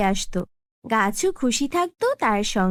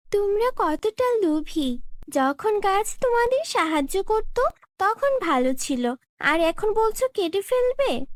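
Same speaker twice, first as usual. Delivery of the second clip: indignant scolding; 7 semitones higher than usual. Acted. Tithi scolds greedy villagers.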